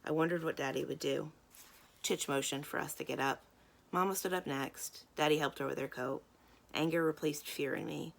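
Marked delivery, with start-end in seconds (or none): none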